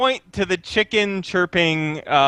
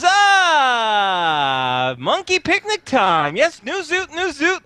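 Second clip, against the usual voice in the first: high, shouted outburst gliding down, then squeaky glee; excited New Zoot; 9 semitones higher, much slower than usual. Acted. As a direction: burst in gleefully, pitched way up. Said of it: "New Zoot himself goes".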